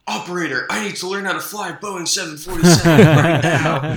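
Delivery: loud, hurried mock movie plea; movie character calling Operator. Riffing on Neo needing to fly a plane, hammed-up urgent call. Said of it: "What's the guy's name?".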